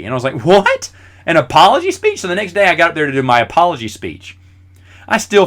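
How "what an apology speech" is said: incredulous. Retold or imitated. Stunned by teacher's demand.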